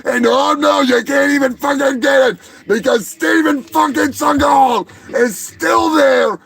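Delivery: high-pitched, strained comic yelling; exasperated rant. Acted. Birthday boy denied his cake by Seagal, exasperated rant. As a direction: build into a relentless frustrated shouting tirade.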